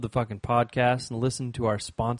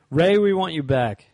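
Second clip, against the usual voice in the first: loud, higher plea to come back; calling out; 4 semitones higher, 8 dB louder than usual. Real, not acted. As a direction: call out to an absent friend. Calling out to absent co-host.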